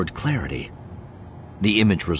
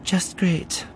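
nervous; 11 semitones higher than usual. A narrator's reading. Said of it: "She takes a deep breath".

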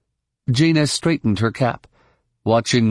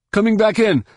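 high, urgent pilot's radio call; voice over comlink; 11 semitones higher than usual. A narrator's reading. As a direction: report in over the comm, pitched well up. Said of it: "a voice said out of Jaina's link box".

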